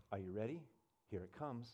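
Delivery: low, hushed inside voice; inner voice. Retold or imitated. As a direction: drop to a quiet aside, warning what is coming. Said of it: "I hear".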